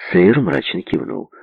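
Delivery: plain reading voice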